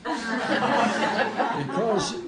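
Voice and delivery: bursts into a laugh, then speaks; real laugh breaking into the word; chuckling at the film's title. Real, not acted. Laughs at his own joke.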